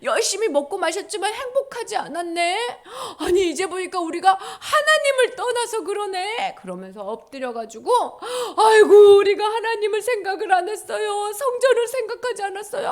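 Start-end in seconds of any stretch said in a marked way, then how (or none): 0.0-6.5 dawning realization. Acted. People realizing their mistake. 8.6-12.9 high, loud, tearful wail; repentant wail. Acted. The people wailing out their repentance to God.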